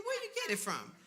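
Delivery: high squeaky suspicious ask; suspicious question. Retold or imitated. Friends asking where the microwave came from, high and suspicious. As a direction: friends squinting at the too-good deal.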